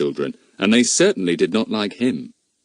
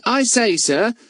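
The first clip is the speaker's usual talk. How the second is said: put-on high, eager boyish question; curious boy; 13 semitones higher than usual. A narrator's reading. Telegraph boy asks the policeman about Fatty, eager and high. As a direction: ask it like a nosy young messenger.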